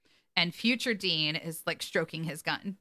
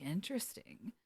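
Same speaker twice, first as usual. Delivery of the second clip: detached murmur; 12 dB quieter than usual. Retold or imitated. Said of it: "Cass is like".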